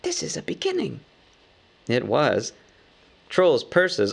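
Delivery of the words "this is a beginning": high, hushed little hobbit voice, a touch pleased; pleased hobbit whisper. Acted. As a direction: whisper it up high, quietly proud. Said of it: "warming to his new work".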